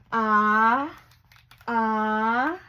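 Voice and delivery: loud building ah before a sneeze; pre-sneeze gasp. Acted. Elephant about to sneeze.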